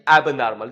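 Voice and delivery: loud model of एबनॉर्मल with stress on नॉर; word drill. Shown as a demonstration. Teacher calling out the word for students to repeat. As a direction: say एबनॉर्मल as ab-NOR-mal, firmly. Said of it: "क्या चीज?".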